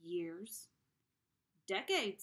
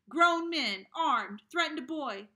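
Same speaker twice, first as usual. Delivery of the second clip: loud, higher retold shout; Pop shouting; 7 semitones higher, 11 dB louder than usual. Father shouting about the murder of Emmett. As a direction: rage about it like an outraged father. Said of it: "I remember Pop shouting".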